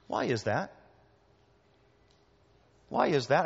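usual speaking voice

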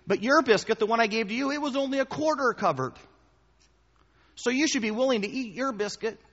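high, fast, loud pleading argument; buddy arguing the biscuit is fine, in a high, pushy voice; persuasive friend. Retold or imitated. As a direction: talk him into eating it, all high-pitched. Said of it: "he goes".

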